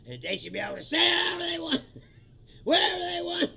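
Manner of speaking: fast and loud, laughing through it; loud sarcastic rant, breaking into laughs; mock outraged shout. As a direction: shout their entitlement back at them. Mocking the bigots' entitlement.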